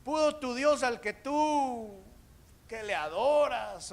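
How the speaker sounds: high, drawn-out call; acted king's long holler. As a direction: call to someone far down. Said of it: "llegó y le dijo".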